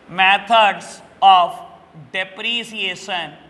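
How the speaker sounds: "methods of depreciation" very slow, with pauses between words; dictation. Shown as a demonstration. Teacher repeating the topic for students, slow dictation of "methods of depreciation". Repeats the term for note-taking.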